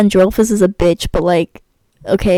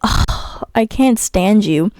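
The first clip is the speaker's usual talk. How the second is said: normal talking voice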